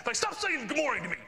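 high-pitched mock outburst of irritation; super mad. Angry person refusing his morning greeting, hammed-up exasperation. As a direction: act out someone fed up and fuming. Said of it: "then get super mad".

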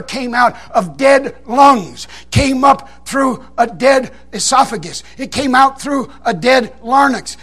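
slow, pause-laden build; deliberate, drawn-out comic emphasis; mock-solemn explanation. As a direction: spell it out organ by organ, stretching the punch line.

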